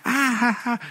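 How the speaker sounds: high mock giggle of a little boy; dad mimicking his son's giggle; imitating toddler laugh. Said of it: "And he goes".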